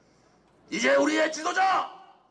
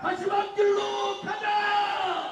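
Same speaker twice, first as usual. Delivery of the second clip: slow shouted slogan; roaring rallying speech; 3 semitones higher, much slower than usual. Real, not acted. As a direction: chant it for the crowd to repeat. Leader chants slogan for crowd.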